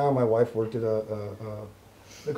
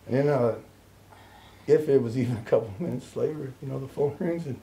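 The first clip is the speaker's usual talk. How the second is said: usual speaking voice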